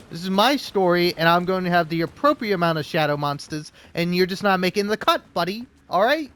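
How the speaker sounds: loud, high mock rant. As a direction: scold the monsters, hammed up. Ranting at shadow monsters.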